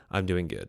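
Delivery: "I'm doing good." falling at the end; falling answer. Shown as a demonstration. Showing how an answer drops. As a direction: settle the answer downward. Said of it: "it goes down".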